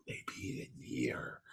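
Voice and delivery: hushed, voiceless whisper. Retold or imitated. Comedian to Myers beside man writing check, whispering. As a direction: whisper it low to the person beside you.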